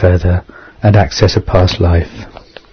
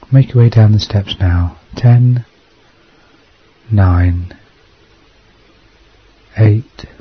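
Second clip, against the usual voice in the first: guided meditation. Acted. Leads a hypnosis meditation.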